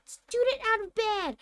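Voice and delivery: high, loud ghostly bellow. Acted. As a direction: raise the alarm, yelling the castle awake. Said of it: "Peev bellowed".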